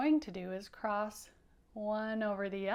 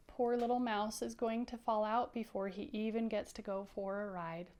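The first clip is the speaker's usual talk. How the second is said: ordinary talk, nothing marked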